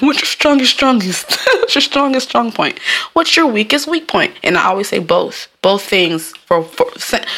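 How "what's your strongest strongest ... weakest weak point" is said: high put-on interviewer voice; mock interviewer. Job interviewer asking strengths and weaknesses, high mock-official voice. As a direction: imitate an interviewer, voice pitched up. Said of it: "They were like".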